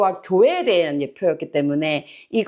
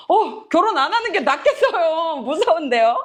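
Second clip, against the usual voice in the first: mock alarm; 7 semitones higher than usual. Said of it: "제자들 반응이 더 웃깁니다".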